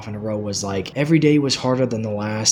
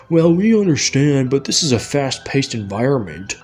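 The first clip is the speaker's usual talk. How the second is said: raised, mock-condescending imitation; put-on dismissive boss voice; mocking supervisors; 3 dB louder than usual. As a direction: mimic the bosses with scornful emphasis. Mimicking dismissive supervisors in retelling.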